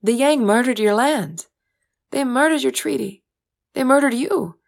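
bitter outburst. Acted. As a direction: spit out each murdered. Uma's bitter outburst about Yang.

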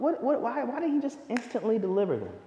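ordinary talk, nothing marked

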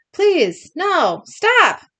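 high, loud plea; acted pleading. A narrator's reading. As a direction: beg them to stop. Susie pleads behind a locked door.

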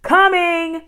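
high, loud, sustained call; shouted reply. A narrator's reading. Girl answers her mother from the window, shouting. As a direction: holler back across a distance. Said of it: "she shouted".

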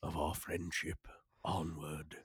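slow, grand storybook narration; mock epic narrator. Podcast guest hamming up their shared past as a grand narrator. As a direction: intone it like an old film narrator. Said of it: "okay, I'm done".

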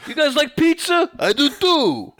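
put-on very high, loud New York accent; hammed-up cartoon hero with a New York accent, laughter creeping in; New York Spider-Man. Auditioning for a Spider-Man cartoon, excited New York accent. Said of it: "me doing an audition for Spider-Man".

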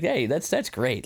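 ordinary talk

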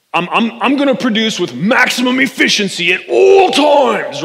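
over-the-top wrestler promo bellow, high and loud; hammed-up macho workaholic hype, building to a roar; Macho Man Randy Savage. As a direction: bellow it like a pumped-up pro wrestler. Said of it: "Macho Man Randy Savage".

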